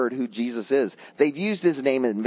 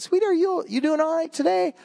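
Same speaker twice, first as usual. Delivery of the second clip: gentle concern; 12 semitones higher than usual. Retold or imitated. Asking the frowning cashier on Christmas Eve if she's okay, gentle and caring. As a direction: ask the sad cashier kindly how she's doing.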